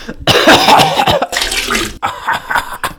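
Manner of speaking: long heaving cough; drawn-out, distressed hacking; coughing fit. Acted. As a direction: cough and heave as if sickened by bad news. Coughing fit over the news.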